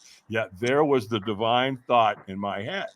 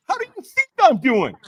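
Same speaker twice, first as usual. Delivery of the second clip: mock angry retort; 13 semitones higher, 3 dB louder than usual. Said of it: "I'm going".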